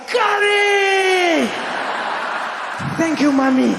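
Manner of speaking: very high, loud, drawn-out mock-joyful shout; ecstatic scream. Boy tasting curry for the first time, screaming with delight. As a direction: squeal the first word long, a hammed-up rapture. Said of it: "I just, I was like".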